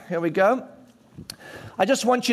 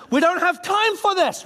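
impatient crowd; 8 semitones higher than usual. Retold or imitated. Acted impatient crowd brushing off beggar.

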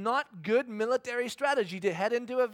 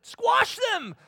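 much higher, louder shout; 8 dB louder than usual. Retold or imitated. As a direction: bellow it like the crowd did. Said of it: "several people shouted it".

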